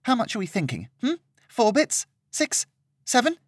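put-on a pampered young lady complaining; spoiled indignant lady. Serena complaining to Peter about the lodgings, high and indignant.

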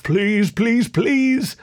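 high, rising anxious plea; mildly desperate begging, climbing in pitch; pleading to himself. A narrator's reading. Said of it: "I whispered to myself".

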